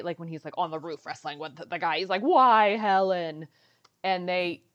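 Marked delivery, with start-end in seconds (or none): 2.2-3.5 loud, mock-dramatic plea; Harrison Ford. Imitating the hero wrestling on the roof, hammed-up loud cry. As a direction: cry it out like a movie hero mid-fight. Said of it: "Harrison Ford says at one point".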